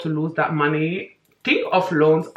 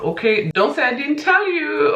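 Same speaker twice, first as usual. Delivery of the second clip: pitched up, playful told-you-so; teasing warning; 10 semitones higher than usual. Real, not acted. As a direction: warn them with a teasing lift.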